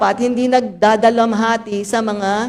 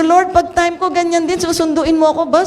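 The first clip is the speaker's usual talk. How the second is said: playful complaint to God; 7 semitones higher than usual. Retold or imitated. Widow retelling her plea to the Lord, mock whine. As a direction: tease the Lord that it isn't fair. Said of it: "Sabi ko".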